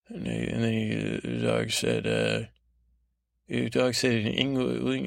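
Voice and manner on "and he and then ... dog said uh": ordinary delivery, nothing marked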